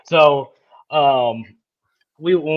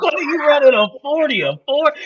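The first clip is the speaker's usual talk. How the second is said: a lighter, higher replay of the coach's yell; retelling the coach; 10 semitones higher, faster than usual. Retold or imitated. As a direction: toss off the coach's line again between laughs. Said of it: "had this old country ass accent".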